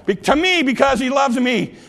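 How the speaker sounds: ordinary talk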